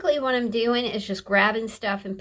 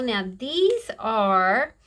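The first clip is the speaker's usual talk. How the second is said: ordinary delivery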